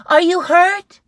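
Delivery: high, loud mom calling. Acted. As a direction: call across the house. Mom voiced in story.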